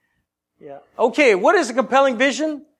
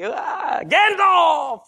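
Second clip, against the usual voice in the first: mock Gandalf cry; 6 semitones higher, much slower than usual. Mock Lord of the Rings cry.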